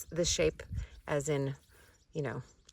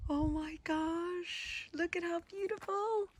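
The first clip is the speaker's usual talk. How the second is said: much higher, softer, drawn-out delight; delighted squeal; 12 semitones higher, 5 dB quieter, slower than usual. Real, not acted. Gardener admiring her first dahlia, high soft delight. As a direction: coo over the flower, stretching each word slowly.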